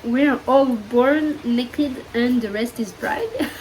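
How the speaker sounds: slow, careful English in a French accent; self-conscious French-accented English. Real, not acted. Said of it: "attention, mon accent anglais".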